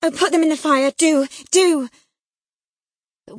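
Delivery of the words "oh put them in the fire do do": high, loud begging; frantic plea. A narrator's reading. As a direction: beg urgently. Girl begging letters be burned.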